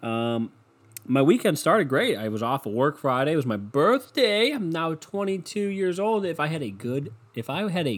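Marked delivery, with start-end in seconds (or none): none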